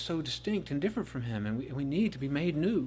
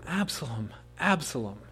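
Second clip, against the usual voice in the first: grieving cry. Acted. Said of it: "as David cries for the loss".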